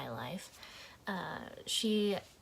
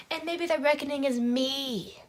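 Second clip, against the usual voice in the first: loud, raised-pitch dramatic outburst; theatrical declaration; 5 semitones higher, 7 dB louder than usual. Shown as a demonstration. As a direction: proclaim it high and loud. Overacted take on the monologue.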